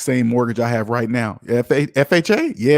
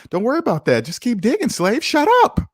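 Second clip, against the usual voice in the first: mock boss; 9 semitones higher than usual. Boss at top of hole.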